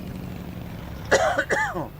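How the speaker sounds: rough hacking cough after a siren impression; real painful coughing; harsh coughing fit. Real, not acted. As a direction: cough hard, throat scraped raw. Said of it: "that hurt".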